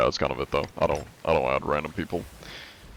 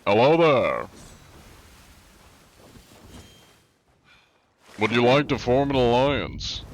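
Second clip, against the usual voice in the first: lightly put-on prim gentleman, a bit higher; polite gentleman pirate; 4 semitones higher than usual. Streamer hails a rival crew, lightly voiced courteous gentleman pirate. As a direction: sweet-talk the strangers like an overly courteous pirate.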